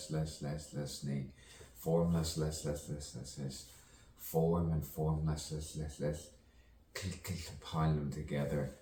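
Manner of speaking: playful stuck-record stutter; stuttering and spluttering. Pretend. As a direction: trip over the syllables, repeating them like a skipping record. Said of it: "stuttering and spluttering".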